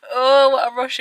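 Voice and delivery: 1 flustered. Real, not acted. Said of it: "breathless after rushing".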